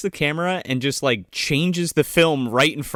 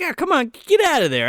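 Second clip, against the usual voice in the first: high-pitched imitated ribbing; mock teasing; 11 semitones higher, 3 dB louder than usual. Co-host repeating his jibe at the woman, high teasing voice. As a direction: brush her off jokingly, voice pitched up. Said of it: "he makes fun of her".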